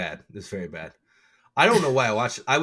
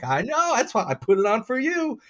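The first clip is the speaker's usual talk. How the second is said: much higher, sing-song greeting voice; imitating the receptionist; 11 semitones higher than usual. Mimicking the dentist's receptionist greeting him, high imitation. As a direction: put on her bright welcome, pitched way up. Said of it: "She's like".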